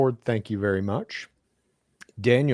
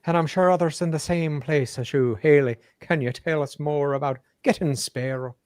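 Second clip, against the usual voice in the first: put-on Irish accent; 5 semitones higher than usual. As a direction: read the message in a stagey Irish voice. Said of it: "That was my Irish accent".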